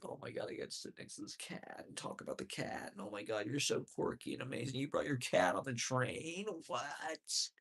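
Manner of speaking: whispered, rising mock gush over quirky cat; mock a starstruck stranger; hushed sarcastic gushing. Mocking what he wouldn't say.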